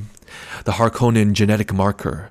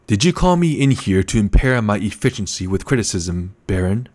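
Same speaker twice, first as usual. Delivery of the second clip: ordinary delivery, nothing marked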